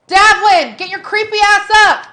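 high, loud yell at him. Acted. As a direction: shout it through the speaker. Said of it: "I yell through the camera".

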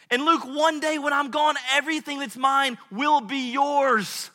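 high, loud, emphatic fatherly vow; impassioned declaration. Retold or imitated. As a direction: build to a ringing promise of love. Father declares love to son.

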